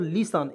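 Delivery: लिसन with no T sound; silent T. Shown as a demonstration. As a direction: say लिसन as "li-san". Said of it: "क्या पढ़ेंगे दोस्तों".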